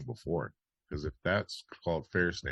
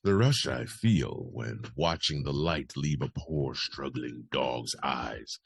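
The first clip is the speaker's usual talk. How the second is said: sinister confession; slower than usual. Acted. Host restarts the parody quote in character, sinister confession. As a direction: relish it darkly, drawing out the words. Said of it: "I should be reading this differently".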